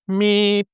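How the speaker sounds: high imitated pitch-pipe beep; short high hummed starting note. Singer recalling the backstage starting note, imitated pipe beep. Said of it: "got the right note to start on".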